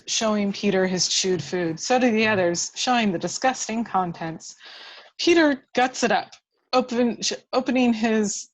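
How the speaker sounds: ordinary talk, nothing marked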